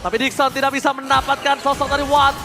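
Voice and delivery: shouted hype play-by-play; fighting game announcer. Acted. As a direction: scream the teamfight call. Live play-by-play at full hype.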